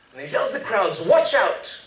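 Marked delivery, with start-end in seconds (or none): 1.1-1.8 louder and higher, a firm warning; stern warning. Acted. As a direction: warn the crowd firmly. Jesus warns the crowds.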